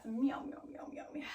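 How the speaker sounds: whispered string of meows; airy, whispery meows trailing off; cat breath meows. Shown as a demonstration. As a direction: meow on the out-breath, voiceless and fading. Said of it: "cat breath".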